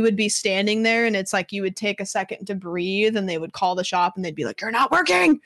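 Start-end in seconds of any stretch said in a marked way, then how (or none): 4.6-5.4 high-pitched mock telling-off; scolding bosses. Owners calling the shop to catch slackers, mock scolding. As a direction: imitate a nitpicking boss on the phone.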